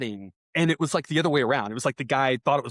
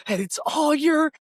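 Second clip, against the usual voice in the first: much higher, re-enacting a furious yell at the umpire; retold angry yell; 14 semitones higher, slower than usual. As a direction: scream it at the ump, pitch way up. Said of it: "I was yelling and screaming".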